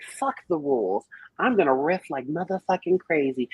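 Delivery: usual speaking voice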